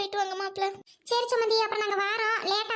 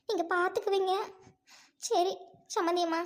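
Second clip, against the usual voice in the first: ordinary talk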